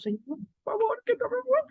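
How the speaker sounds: hammed-up terrified prayer; mock desperate plea. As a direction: beg God like a frightened sinner. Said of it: "regresamos con Dios bien arrepentidos".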